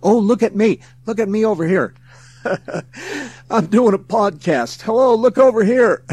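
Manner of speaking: high, loud hammed-up show-off; mock attention seeking. Host mocking his own flashy podcast title, show-off voice. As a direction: wave your arms for attention, half laughing. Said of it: "I did that to get attention".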